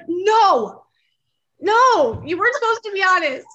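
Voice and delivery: loud, high protest; upset retort. Retold or imitated. Upset wife retorting loudly.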